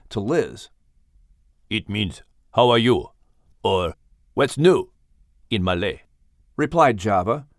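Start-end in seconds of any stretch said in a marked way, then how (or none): 1.7-6.0 low, mysterious big cat voice; deep panther with Malay accent. Acted. Java the panther.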